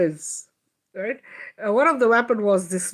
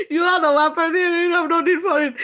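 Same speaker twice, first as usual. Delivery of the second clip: high, loud voice breaking into laughter; laughing through words; 9 semitones higher, 5 dB louder than usual. Retold or imitated. Quoting higher self while laughing hard, high and loud. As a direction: say it while cracking up. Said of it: "my higher self is like".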